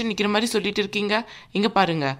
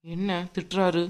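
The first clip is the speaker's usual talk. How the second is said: softer, to herself; muttered aside; 3 semitones lower, 6 dB quieter than usual. Acted. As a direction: grumble it under your breath.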